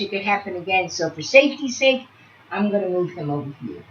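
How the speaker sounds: ordinary talk, nothing marked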